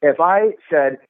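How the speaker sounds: ordinary delivery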